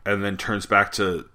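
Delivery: ordinary talk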